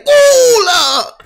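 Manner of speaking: frustrated shout. Real, not acted.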